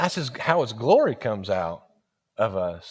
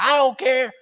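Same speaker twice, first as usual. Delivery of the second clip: sharp, louder and higher refusal; curt retort; 13 semitones higher, 5 dB louder than usual. Retold or imitated. Pumpkin worker brushing him off, sharp and loud. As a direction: snap it off like a fed-up clerk.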